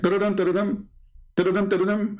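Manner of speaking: ringtone sung out with the mouth; mock phone ring; imitated phone ringing. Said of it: "See how fast the ringing?".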